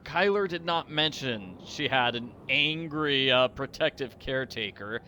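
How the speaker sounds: ordinary delivery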